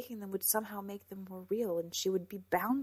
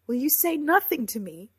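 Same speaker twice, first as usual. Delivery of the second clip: strong, high, loud demand; tears turned to anger; angry demand; 6 semitones higher, 12 dB louder than usual. A narrator's reading. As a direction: demand an answer in raised voice. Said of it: "her tears quickly turned to anger".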